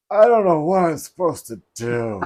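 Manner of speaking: high, loud comic wail; mock helplessness. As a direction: play the hopelessly lost newbie. Acts out own clueless reply.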